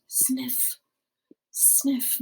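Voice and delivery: quiet unvoiced sniffing; soft sniffles. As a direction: sniff back tears quietly. Boy sniffling.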